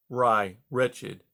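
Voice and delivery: usual speaking voice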